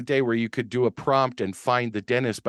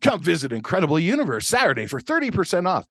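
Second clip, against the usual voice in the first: fast, high announcer read; hammed-up radio ad pitch; ad voiceover; 6 semitones higher, faster than usual. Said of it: "I did the voiceover".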